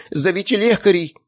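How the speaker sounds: high, panicked call for help; frantic high-pitched plea; shrieking lady. A narrator's reading. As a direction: cry out in a squealing lady's voice. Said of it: "верещала хозяйка собаки".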